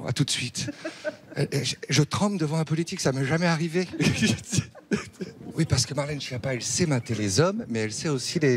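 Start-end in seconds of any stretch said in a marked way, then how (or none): 3.9-4.7 high-pitched burst of giggles; high tittering laugh; giggling. Real, not acted. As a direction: giggle after your own confession. Laughs after claiming to tremble.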